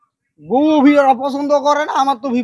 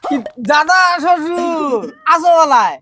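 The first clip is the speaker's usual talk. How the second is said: drawn-out, higher-pitched hail; long, raised greeting call; 5 semitones higher, much slower than usual. Acted. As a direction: call out to an elder, stretching the words. Calls out to grandfather-in-law.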